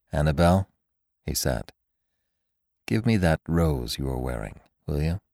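low, soft, quiet request; calm and tender. A narrator's reading. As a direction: ask gently, calm amid panic. Calm tender request amid panic.

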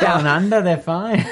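a hint of an Australian accent, laughing; tossed-off mock Australian. Joking on the podcast, a light mock Australian accent. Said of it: "were you trying to be Australian".

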